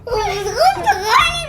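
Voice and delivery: giggling, stretched out; laughing. Real, not acted. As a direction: crack up mid-word. Laughing at bread joke.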